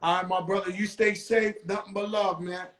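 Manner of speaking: usual speaking voice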